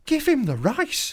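high, slow mock manager. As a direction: give the order high and drawn out. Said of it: "the manager's gone".

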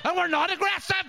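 high-pitched mock Northern Irish outrage; aggressive Northern Irish. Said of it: "and you're going".

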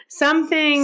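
"Something" lifted high and drawn out; mock-portentous echo. Shown as a demonstration. Host echoing her own idea to co-host, mock-dramatic lift. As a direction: repeat it like a dramatic teaser. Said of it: "Something to think about".

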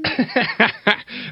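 laugh. Real, not acted. Laughs at own joke.

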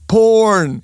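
high, loud drawn-out yell; retold scream. Costumed guest yelling his catchphrase, imitated scream. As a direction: holler it high, imitating the reveller. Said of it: "Så han bara skrek".

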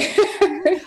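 a quick bright laugh; short laugh. Real, not acted. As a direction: laugh lightly at your own joke. Guest laughs at own joke.

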